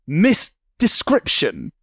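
slow, stretched-out misdescription; drawn out. Acted. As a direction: pull misdescription out slowly. Dwelling on the wrong word.

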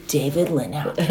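low, hushed echo of the name; Cal. Retold or imitated. Husband repeating his rival's name, low and hushed. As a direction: drop it far down, soft, in imitation. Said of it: "and then Cal goes".

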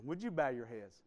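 normal talking voice

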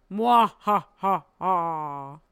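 hammed-up villain cackle; theatrical evil laugh; mock evil laugh. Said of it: "and I was like".

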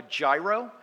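gyro with a J, "JYE-ro"; American mispronunciation. Shown as a demonstration. Speaker showing how Americans order the dish, mispronounced. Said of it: "mispronounce this dish".

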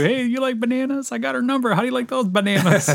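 high, hammed-up cartoon voice; imitating a cartoon character. Retelling Futurama's monkey scene in a high mock voice. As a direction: squeak out the smug banana lines. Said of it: "he pulled that line on him".

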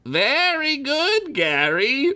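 high, slow wizard voice; drawn-out lordly wizard; grand old wizard. Acted. Wizard Reese speaks.

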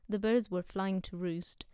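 plain reading voice

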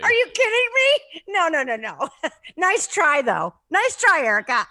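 loud, high teasing through laughter; laughing disbelief. Real, not acted. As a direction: scoff merrily at a friend's claim. Friends laughingly dismiss her wish.

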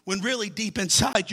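ordinary talk

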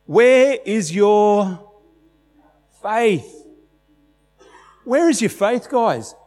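high and loud, drawn-out scolding; high mock-irritated Jesus; testy Jesus. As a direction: rebuke them, slow on where is your. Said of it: "Jesus says".

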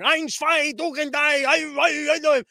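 hammed-up fake German ranting; Biden doing Hitler. Mocking Biden as he rants like a dictator at a rally. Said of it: "Biden was up there going".

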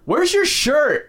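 high, slow mock tease; teasing sister. [name] at the bar teasing the shirtless fighter, slow high mock tease. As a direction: draw out the question playfully.

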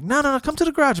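high, coaxing come to the garage; insistent host. Retold or imitated. Host urging the friend into the garage, high and insistent.

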